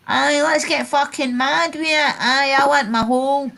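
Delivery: lampoon the lads' Scottish banter; mocking Scottish lads. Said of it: "Aye, and that's the way they talk".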